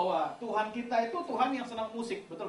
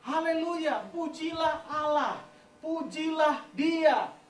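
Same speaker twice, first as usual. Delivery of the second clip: higher, slow, drawn-out proclamation; slow proclaiming; 7 semitones higher, much slower than usual. Shown as a demonstration. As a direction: stretch each phrase like a declaration.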